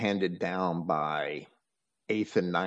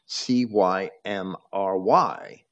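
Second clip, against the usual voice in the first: slow, letter by letter; spelling out; slower than usual. Shown as a demonstration. Speaker spells the British name for listeners, slowly letter by letter. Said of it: "it's spelled a little different".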